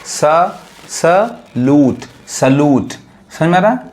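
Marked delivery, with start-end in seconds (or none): 0.0-2.0 very slow सलूट, broken into "suh" and "loot"; drawn-out teaching take of सलूट; syllable-by-syllable model. Shown as a demonstration. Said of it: "इसका सही उच्चारण क्या है". 2.3-2.9 सलूट joined up as "suh-loot"; long oo and no y glide in सलूट; corrected pronunciation. Shown as a demonstration. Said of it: "इसका सही उच्चारण क्या है".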